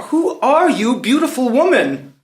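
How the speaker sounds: high, ardent question; enraptured. Acted. Prince asks the unknown beauty who she is, enraptured. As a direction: let the voice leap with fascination.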